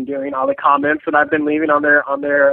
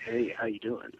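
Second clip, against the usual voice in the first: low, soft sultry phone-line purr; sexy hotline voice; 12 semitones lower, 16 dB quieter than usual. Acted. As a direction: coo it like a paid sex-line operator. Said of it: "that's my one nine hundred sex a lot voice".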